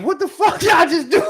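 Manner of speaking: high, loud laughing yell; hammed-up stunned shout; mock shock. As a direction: blurt it dazed and laughing. Retold shock after tackle.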